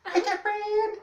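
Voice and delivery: very high, loud calling over the waves; female sea cat calling. Acted. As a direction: shriek for Catherine from far out at sea. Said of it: "it's like".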